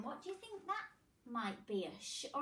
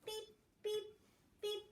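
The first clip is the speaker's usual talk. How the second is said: machine beeps; 9 semitones higher, 4 dB quieter than usual. Pretend.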